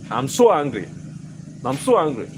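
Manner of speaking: ordinary talk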